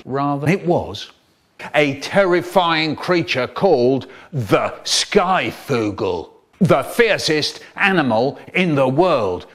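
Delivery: ordinary delivery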